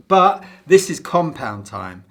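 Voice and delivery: ordinary talk, nothing marked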